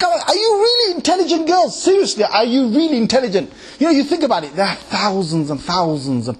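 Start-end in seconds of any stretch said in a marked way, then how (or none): 0.0-3.5 incredulous. Retold or imitated. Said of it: "It's like".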